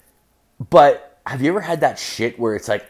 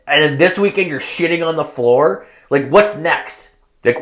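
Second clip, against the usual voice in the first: high-pitched, mock-outraged tirade; indignant scolding; 6 semitones higher than usual. Fed-up girlfriend berating boyfriend over the mess, indignant. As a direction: pile up exasperated questions as the fed-up girlfriend.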